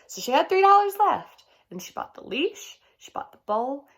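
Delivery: lively storybook read-aloud with voiced characters; reading to children. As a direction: read it like story time for kids. Teacher reads picture book aloud.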